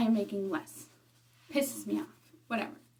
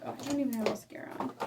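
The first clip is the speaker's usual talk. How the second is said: normal talking voice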